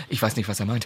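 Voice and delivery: low, soft aside to camera; confidential aside. Retold or imitated. Heroine turns to the camera, imitated hushed aside. Dreht sich in die Kamera so.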